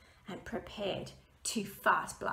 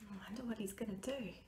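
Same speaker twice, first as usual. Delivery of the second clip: aside; 8 dB quieter, much faster than usual. Real, not acted. Reader aside to listeners.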